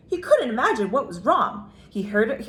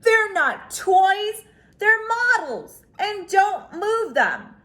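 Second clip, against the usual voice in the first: put-on higher, slow insistent young cub; a boy defending his models; protesting brother; 9 semitones higher, 4 dB louder, slower than usual. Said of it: "Brother protested".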